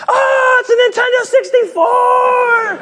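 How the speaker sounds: very high, squealing with delight; excited shout. Pretend. Preacher acting a kid thrilled by his gift, high squeal. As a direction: shriek like a thrilled kid tearing open a present.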